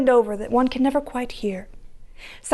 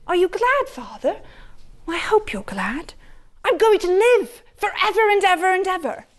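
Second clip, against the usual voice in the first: put-on high, happy boyish voice; a boy sure he'll live forever; joyful boy; 7 semitones higher than usual. To his father, a joyful boy promises to live forever, pitched high.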